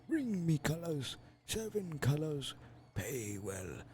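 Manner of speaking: put-on high, quavering old man. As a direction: croak it like a frail, dying elder. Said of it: "give him an old man voice".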